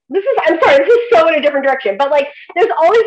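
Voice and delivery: high, loud, laughing through the words; laughing apology. Real, not acted. Guest to host, apologizing for a tangent, laughing.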